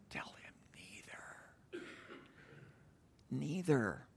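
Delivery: breathy secret whisper under your breath. Retold or imitated. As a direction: hiss advice sideways without moving. Recruit whispering advice at attention.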